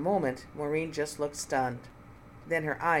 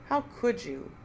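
much higher, hurt reproach; wounded reproach; 7 semitones higher than usual. Acted. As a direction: plead in staged betrayal. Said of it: "Nina moaned".

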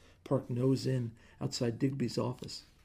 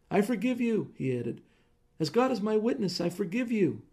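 raised, higher voice, grandly magnanimous; pompous forgiveness; 8 semitones higher, 5 dB louder than usual. Acted. Husband proclaiming forgiveness to his wife, loud and pompous.

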